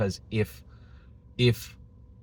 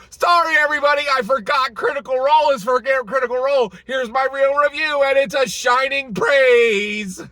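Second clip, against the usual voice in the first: mocking reviewer; 7 dB louder than usual. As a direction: parody the gushing reversal in a high shout. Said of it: "five days later".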